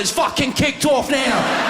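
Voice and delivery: high, loud mock-panicked yell; hammed-up alarmed hard-man shout. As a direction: bellow it slowly in a put-on London voice. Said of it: "He's gone".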